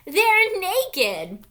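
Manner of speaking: high, loud gleeful punchline; silly little sister, loud and high; giggling girl. A narrator's reading. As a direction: blurt it out with giggly delight.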